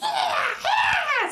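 high, loud creature screech; screeching monster noise. Acted. Game master demonstrating the monsters' noise, screeching. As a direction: imitate shrieking little beasts. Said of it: "these things are screeching".

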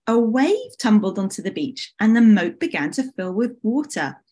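plain reading voice

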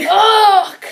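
loud frustrated curse; frustrated shout. Real, not acted. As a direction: shout it in dismay. Loses the first question.

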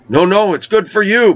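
high, pushy imitation of the healer woman; insistent healer voice. Healer woman insisting he accept treatment, high pushy voice. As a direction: mimic her insisting it's good for him.

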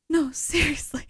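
laughing through deadpan. Pretend. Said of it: "I'm doing the deadpan face".

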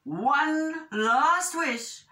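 stern storybook ultimatum; scolding wishfish. A narrator's reading. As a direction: lay down the law, stretching the last warning. Wishfish lays down the law.

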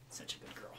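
soft whispered praise. Real, not acted. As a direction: breathe the praise to the dog. Praising dog softly.

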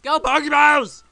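high, loud, stretched-out holler; silly battle cry. Real, not acted. Silly yelled Pokeball call.

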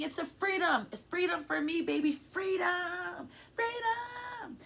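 high, drawn-out scream of release; joyful scream. Real, not acted. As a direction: scream it out high, stretching the last words. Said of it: "made me feel good screaming that".

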